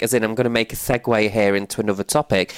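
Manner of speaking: usual speaking voice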